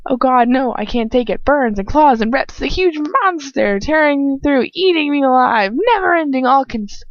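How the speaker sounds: high, loud anguished voice; agonized torture. Acted. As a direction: voice racked by pain. Under torture curse.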